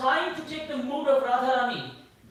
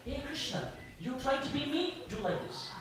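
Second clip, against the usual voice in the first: soft, mostly raised offstage cue; Radharani/character prompting; 2 semitones higher, 7 dB quieter than usual. Acted. Behind the curtain, guiding Krishna in his role, hushed prompting. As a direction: coach discreetly from behind a curtain. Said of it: "Radharani is appearing behind the curtain and saying".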